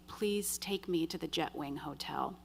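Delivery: soft, nearly whispered request; retold subdued plea. Passenger asking the driver who came back, subdued. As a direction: ask very softly, voice drawn in.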